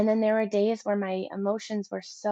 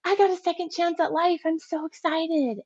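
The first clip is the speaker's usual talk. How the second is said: bright, much higher burst of joy; excited; 9 semitones higher than usual. Retold or imitated. Home from hospital, telling herself about her second chance, thrilled. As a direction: gush like someone handed a second life. Home after coma, feeling reborn.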